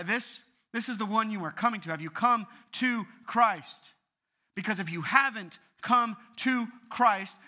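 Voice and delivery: loud, high, hammered pulpit warning; urgent preacher's challenge; impassioned preaching. Real, not acted. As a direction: drive each phrase home at full force.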